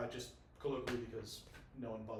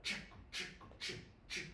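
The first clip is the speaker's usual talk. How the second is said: unvoiced, choppy drum noises; choppy drum imitation; 3 semitones higher, 10 dB quieter than usual. Shown as a demonstration. Drummer showing a choppy drum part, imitated drum sound. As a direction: softly imitate evenly spaced choppy drum hits. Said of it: "like, choppy drum".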